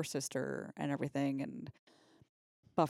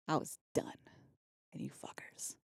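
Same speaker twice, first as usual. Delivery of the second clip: whispering; 5 dB quieter than usual. Retold or imitated. Whispered as Buffy.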